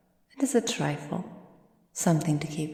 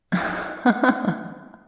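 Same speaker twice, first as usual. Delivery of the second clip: louder cruel breathy laugh, pitched up; gleeful menacing laugh; 7 semitones higher, 5 dB louder than usual. Acted. Vampire relishes breaking arm.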